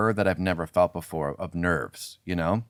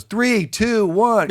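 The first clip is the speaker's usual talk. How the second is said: high, loud squeaky countdown; a panicked crew guy counting in; hammed-up high countdown; mock frantic countdown; 14 semitones higher, 6 dB louder, much slower than usual. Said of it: "He goes".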